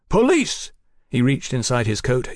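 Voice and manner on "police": high-pitched warning shout. Acted. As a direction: call it out as an officer's warning. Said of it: "Fraser shouted".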